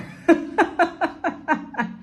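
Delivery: a high, airless burst of laughter; high breathy laugh. Real, not acted. Vlogger laughing at the sold-out chant. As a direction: laugh it off in disbelief.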